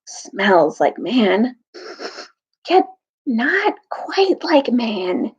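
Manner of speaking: slow, hushed badger voice; old badger murmuring suspicion; slow sniffing badger. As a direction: sniff the air and puzzle it out slowly. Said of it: "whispered Truffle Hunter".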